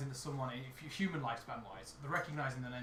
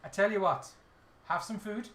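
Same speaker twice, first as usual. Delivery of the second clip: higher, Welsh lilt; accidental Welsh; 5 semitones higher, 5 dB louder than usual. Acted. Irish character offering food, accent slipping into Welsh. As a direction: let the accent slide into singsong Welsh. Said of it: "Oh, I went well, sir".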